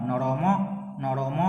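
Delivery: নরম said slowly as "noromo"; drawn final vowel. Shown as a demonstration. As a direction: let the ম of নরম open into a long o. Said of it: "অকে টানতাম".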